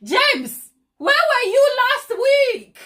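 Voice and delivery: big theatrical telling-off; mock mad. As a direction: pretend to scold him, drawn out. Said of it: "Now I'm getting mad".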